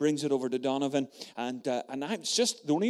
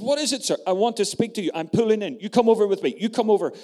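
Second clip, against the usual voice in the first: raised voices; 8 semitones higher, 9 dB louder, faster than usual. Retold or imitated. Paper seller and Donovan at traffic light.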